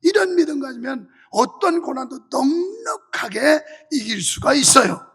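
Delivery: loud, forceful sermon delivery; preaching. Real, not acted. Pastor urging congregation to endure through faith, forceful preaching. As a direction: preach it with driving conviction.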